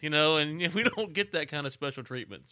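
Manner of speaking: ordinary talk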